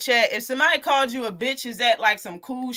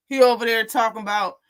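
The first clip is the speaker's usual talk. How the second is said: usual speaking voice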